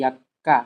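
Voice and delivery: यक्का with the क held double and stressed; doubled consonant. Shown as a demonstration. Teacher shows doubling the consonant in यक्का. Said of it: "दो बार पढ़ दी".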